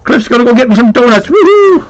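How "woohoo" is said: high happy woohoo; excited cheer. Real, not acted. Cheering about donuts.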